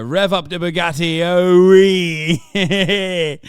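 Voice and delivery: loud British geezer whoop; mock British accent. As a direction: cheer like a lad revving his car. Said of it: "Terrible British accent".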